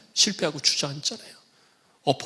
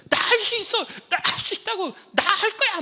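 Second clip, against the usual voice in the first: put-on high, louder stubborn kid voice; insistent child; 3 dB louder than usual. Small child insisting to dad he can do it, high. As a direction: whine it like a stubborn little child.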